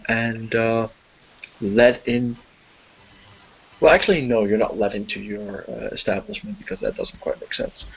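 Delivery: normal talking voice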